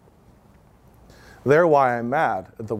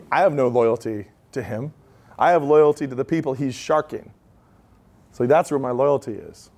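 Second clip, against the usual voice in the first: ordinary talk, nothing marked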